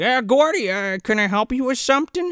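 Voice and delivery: put-on a suspicious pharmacist catching a kid; imitating the pharmacist. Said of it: "the pharmacist is like".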